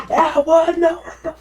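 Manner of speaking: high, loud panicked yelp; startled yell. Retold or imitated. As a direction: shout it, shocked and laughing. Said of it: "that's when I was like".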